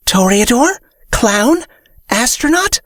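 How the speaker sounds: high, rising eager guesses; eager guessing. Acted. Fairy guesses.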